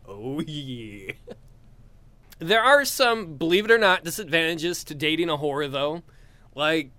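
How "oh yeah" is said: low aroused murmur. Acted. Aroused reply, low and soft.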